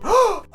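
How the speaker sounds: high sudden gasp; eureka gasp. A narrator's reading. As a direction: gasp at the idea. Steve gets an idea.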